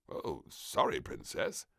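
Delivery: apologetic minister; mild low minister voice; lightly voiced prime minister. A narrator's reading. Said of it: "said the Prime Minister".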